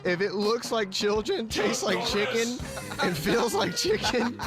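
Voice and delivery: ordinary talk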